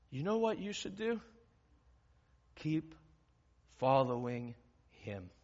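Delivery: ordinary delivery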